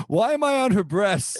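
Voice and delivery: high bewildered voice; squeaky puzzled character; confused character voice. Pretend. Voicing confused penis.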